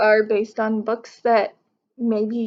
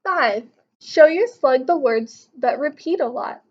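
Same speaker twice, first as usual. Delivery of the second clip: ordinary talk, nothing marked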